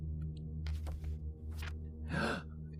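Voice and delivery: soft theatrical intake of breath; mock gasp. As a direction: fake being scandalised. Villain finds love note.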